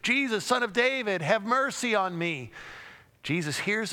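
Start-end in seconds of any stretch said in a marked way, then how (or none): 0.0-2.5 high insistent cry; repeated shouting. Acted. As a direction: keep calling louder to be heard. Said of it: "just shouting louder and louder".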